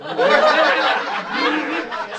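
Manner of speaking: a long loud high burst of laughter; big laugh. Real, not acted. Comic cracking up at the delirious line.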